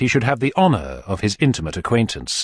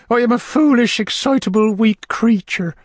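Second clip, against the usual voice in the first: high falsetto, slowing at the end; self-pitying lady; 13 semitones higher, 4 dB louder than usual. A narrator's reading. Matron confesses to the beadle, high self-pitying voice. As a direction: lament your weakness in a very high voice. Lady laments her weakness.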